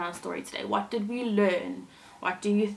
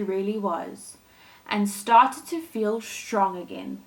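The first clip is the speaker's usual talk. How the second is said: gentle storybook reading voice; storyteller reading a fairy tale to kids; story narration; 2 semitones higher, 4 dB louder than usual. A narrator's reading. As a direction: read it like a bedtime story for children. Reads Thumbelina story aloud.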